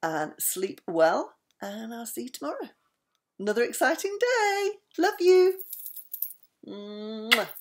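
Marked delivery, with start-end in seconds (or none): 3.4-5.6 high, affectionate send-off; excited sign-off. Real, not acted. Nana saying goodnight to the children, excited and loving. 6.7-7.5 long "mwah" kiss sound; blown kiss. Real, not acted. Nana kissing the children goodnight, big affectionate mwah.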